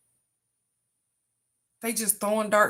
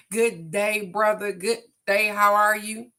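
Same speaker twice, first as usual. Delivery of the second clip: hearty greeting; 7 dB louder than usual. Real, not acted. Greeting a viewer who joined.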